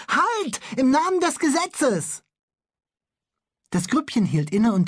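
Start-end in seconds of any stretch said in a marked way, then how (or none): 0.1-2.2 high, urgent cry of the law; commanding shout. Acted. Bülle shouts at the policemen to halt. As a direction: shout like a little officer. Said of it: "rief Bülle".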